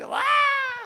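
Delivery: shrill-pitched hammed-up cry; high mock wail. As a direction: wail like a child. Imitated child wail.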